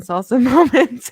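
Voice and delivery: laughing. Real, not acted. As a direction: giggle out the words. Laugh heard.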